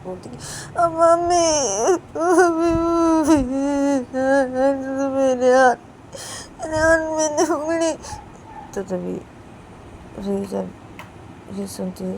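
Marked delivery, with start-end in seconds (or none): 0.8-7.9 put-on loud, high wailing child; hurt little girl crying; crying in pain. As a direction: wail for mummy. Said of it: "चीखने लग जाती है".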